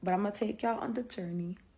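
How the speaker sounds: whispering. Real, not acted. Whispered aside to viewers.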